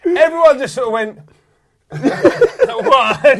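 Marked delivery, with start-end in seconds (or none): 1.9-3.4 loud, high laughing "what"; hammed-up disbelief through laughter; mock shock. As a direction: blurt it like the stunned crowd. Crowd's reaction to shock.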